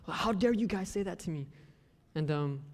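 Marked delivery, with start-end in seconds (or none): 0.1-1.5 mock indignation, slightly higher; hammed-up touchy outrage; very emotional. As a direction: overreact as if wounded by a silly slight. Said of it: "I used to be very emotional".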